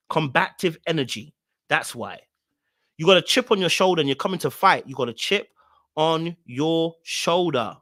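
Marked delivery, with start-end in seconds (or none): 3.0-4.9 rapid run-through in one go; quick accusation. Real, not acted. Host calling out Tequila's combative energy, quick accusation. 4.9-7.8 "on your shoulder" spaced out word by word; slow right down on "on your shoulder", each word separate; slow emphatic repeat. Real, not acted. Host driving home the chip on her shoulder, slow and emphatic.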